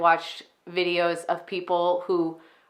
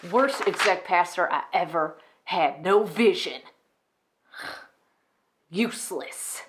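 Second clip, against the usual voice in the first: bitter contempt. Acted. Enid, surrounded by people leeching off her, bitterly contemptuous. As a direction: drag out each insult with cold disdain. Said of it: "a character on an extreme end of narcissistic".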